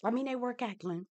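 high, soft, sneaky aside; hushed, high-pitched confession; reluctant admission. Real, not acted. Sheepish agreement with the insult.

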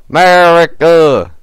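slow, loud America; rousing chant of America; drawn-out patriotic cry. Acted. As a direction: stretch it out proudly.

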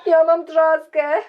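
slow, loud whiny moan; drawn-out pained complaint; complaining of pain. Acted. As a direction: bewail the sore paw. T-Rex with painful splinter.

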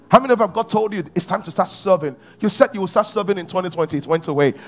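ordinary talk

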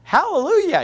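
high, drawn-out delighted wonder; imitated joyful amazement. Preacher imitates people happy at being saved, joyful amazement. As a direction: imitate a thrilled new convert marvelling. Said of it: "they, they're just".

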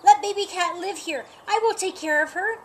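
loud, high pleading bark. Acted. Buster begging Roger to keep the kitten, barking loudly. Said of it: "Buster barked".

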